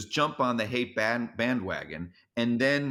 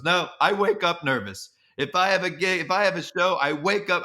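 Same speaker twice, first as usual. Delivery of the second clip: louder, higher insistent impression; Larry; 6 semitones higher, 4 dB louder than usual. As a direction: imitate an emphatic correction, pitched up. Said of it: "He goes".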